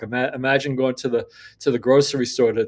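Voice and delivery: normal talking voice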